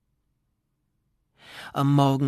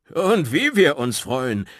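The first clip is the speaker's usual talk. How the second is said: sehr laut, höher und langsamer gerufen; freudiger Ruf; 7 semitones higher, 9 dB louder, slower than usual. Acted. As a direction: jubelnd zurückrufen. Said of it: "riefen Boris und Welemir zugleich". (Boris und Welemir rufen Goliath freudig zu)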